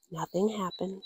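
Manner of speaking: usual speaking voice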